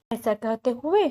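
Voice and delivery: usual speaking voice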